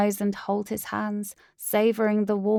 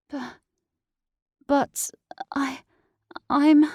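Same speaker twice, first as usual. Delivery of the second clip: stammering; 6 semitones higher, 5 dB louder, slower than usual. Acted. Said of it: "I can't even speak properly".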